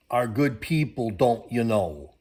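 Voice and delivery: put-on tag the line with a Minnesota accent; lightly mocking Minnesota accent. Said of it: "That's what they talk like up here".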